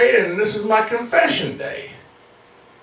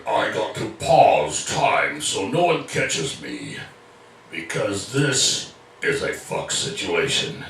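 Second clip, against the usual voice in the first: deep, slow, dragged-out slow-motion voice; slowed-down time voice; 12 semitones lower, 4 dB quieter, slower than usual. Pretend. Comedian mimicking his own slowed-down voice as time stops. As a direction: stretch every word like time just froze.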